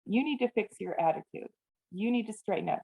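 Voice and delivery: ordinary talk, nothing marked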